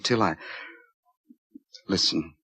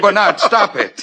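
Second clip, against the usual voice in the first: higher, angry stop it; 10 semitones higher than usual. Acted. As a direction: snap at him to stop. Angry at being mocked.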